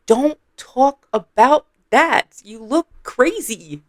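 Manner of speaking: high, slow, drawn-out scolding; exaggerated mock disgust. Vlogger mocking Sophia's insult, slow high appalled scolding. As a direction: stretch it out, appalled.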